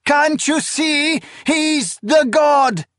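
high, drawn-out, frantic screaming; desperate shrill-pitched protest; shriek. A narrator's reading. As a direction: scream it, begging them to understand. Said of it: "she shrieked".

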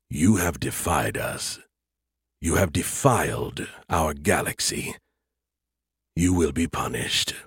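low, menacing alien entity; cold alien voice. Acted. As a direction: speak as a cold superior alien. Alien creators speak.

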